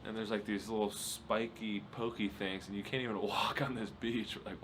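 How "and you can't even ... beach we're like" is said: usual speaking voice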